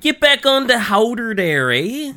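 hammed-up Canadian, high and drawn out; slow, high mock-Canadian call; Canadian accent. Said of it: "you sound so Canadian every time".

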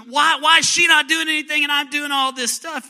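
high, loud frustrated outburst; frustrated Martha. Retold or imitated. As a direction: cry out to Jesus in exasperation. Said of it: "frustrated and going".

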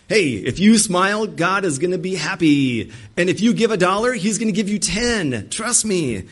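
false teacher luring followers with easy promises; smooth false preacher. Acted. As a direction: sell it like a slick con man.

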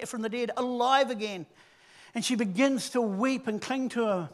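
ordinary talk, nothing marked

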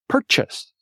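ordinary talk, nothing marked

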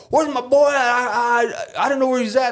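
caricatured Southern worker calling out; mock good old boy. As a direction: play a bossy good old boy hunting his worker. Good old boys searching workers.

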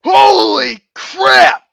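very slow, loud and high; shocked exclaim. Real, not acted. Friend gasping at the scare, loud and drawn out. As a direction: drag it out, stunned.

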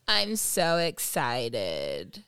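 very slow, low, creaky deadpan; vocal fry. Acted. Mocking the Kardashians' excitement, slow vocal fry. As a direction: draw it out in a mock LA creak. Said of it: "did bring vocal fry".

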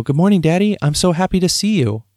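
dad imitating his son's happy good morning; little boy's voice. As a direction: imitate your young son, sweet and eager. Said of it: "he'd go".

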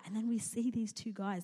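normal talking voice

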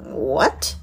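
low, rising 'what' of disbelief; taken aback. Retold or imitated. Woman recalling her reaction to a new explanation, taken aback. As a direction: let the new idea catch you off guard. Acting out astonished reaction to reframe.

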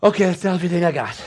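quick, spent mock gasp of words; acted out of gas. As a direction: blurt it out as if on empty. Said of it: "when you're out of gas".

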